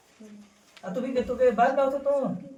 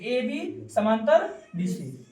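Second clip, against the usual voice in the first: AB समांतर BC spelled out slowly, word by word; slow dictation; much slower than usual. Shown as a demonstration. Teacher slowly dictating AB समांतर BC to the class. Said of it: "अगर हम बोलते हैं AB समांतर BC लिखो".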